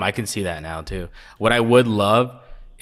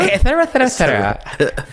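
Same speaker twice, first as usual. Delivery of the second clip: much higher, laughing mock voice; 14 semitones higher, 4 dB louder than usual. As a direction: squeak out the et ceteras mockingly. Mocking imitation continues.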